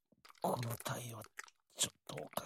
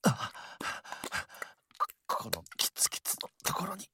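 louder, strained, holding back; straining near climax; 4 semitones higher, 4 dB louder than usual. Acted. Lover telling his partner he can't hold back, louder strained voice. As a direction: push through the words, at the limit. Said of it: "最高".

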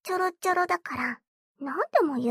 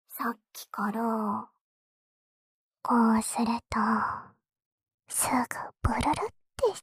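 ear whisper; 3 semitones lower than usual. Acted. Sister whispers into her brother's ear.

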